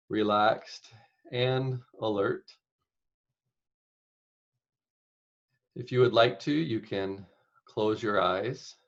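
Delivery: unhurried meditation guide, long pauses between phrases; guided meditation. Acted. Meditation leader inviting listeners to picture caregiving, spacious guided-meditation delivery. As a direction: guide them gently, phrase by phrase, leaving space.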